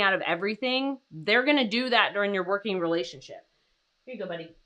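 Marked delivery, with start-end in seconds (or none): 4.0-4.5 much softer, low murmur to the dog; soft to pet. Real, not acted. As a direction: hand it over gently under your breath.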